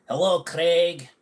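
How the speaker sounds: a light Scottish Craig Ferguson greeting. Pretend. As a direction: play Craig Ferguson saying hello. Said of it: "more like, uh, Craig Ferguson".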